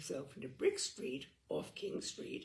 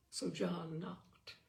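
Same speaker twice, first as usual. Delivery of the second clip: plain reading voice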